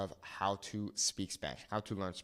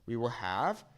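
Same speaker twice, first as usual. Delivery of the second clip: normal talking voice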